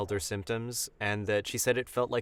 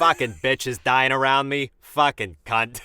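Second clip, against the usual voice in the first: put-on louder Boston accent, crude gripe; Boston accent impression; 6 dB louder than usual. Pat character cursing in a thick Boston accent. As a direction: swear it out like a Boston guy. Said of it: "you're a goddamn impressionist".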